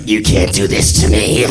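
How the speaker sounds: low monstrous growled protest; turning werewolf. Acted. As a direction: snarl it like a transforming werewolf. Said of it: "turns into a werewolf".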